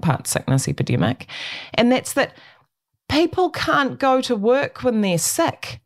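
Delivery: usual speaking voice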